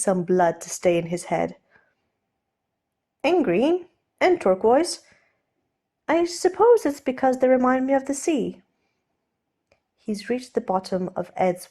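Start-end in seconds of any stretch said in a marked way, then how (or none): 3.2-8.6 raised, lighter voice for the nervous aristocrat; Steed, flustered gentleman. Acted. Steed offering to undo Ed's cravat, nervously polite. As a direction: play Steed, polite and a little jittery.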